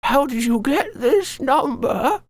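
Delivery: put-on high, slow quavering old woman. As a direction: play a frail old lady, slowly. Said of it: "It'd be a very old woman's voice".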